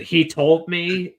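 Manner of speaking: a first, lighter go at her yell; imitated yell. Trying to recall how she yells in the fight, imitated yell. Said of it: "The way she yells".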